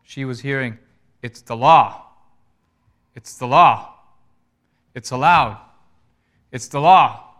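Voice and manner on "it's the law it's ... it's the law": loud, emphatic insistence in an argument; raised-voice quarrel, each phrase hammered; arguing. Retold or imitated. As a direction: argue it hard, punching the last word. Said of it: "him and the man in the office arguing".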